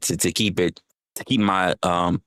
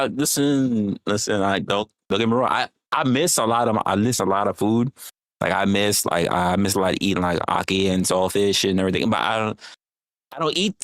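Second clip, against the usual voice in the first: normal talking voice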